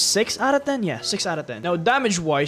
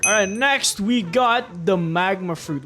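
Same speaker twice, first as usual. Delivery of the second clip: ordinary delivery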